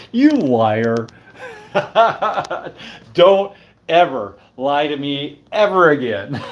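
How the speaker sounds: slow, laughing fake reproach; mock scolding through laughter. Laughing at herself, mock scolding a liar. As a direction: scold yourself while cracking up.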